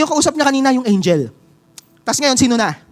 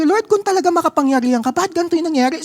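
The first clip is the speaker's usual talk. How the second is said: mock complainer; 7 semitones higher, much faster than usual. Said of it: "mapapatanong tayo".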